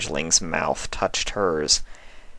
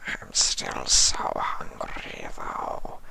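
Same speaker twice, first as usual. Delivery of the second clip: slow, much softer, lower whisper; a starving creature rasping for more; hungry changeling whisper; 12 semitones lower, 12 dB quieter, much slower than usual. A narrator's reading. Changeling voice held in story.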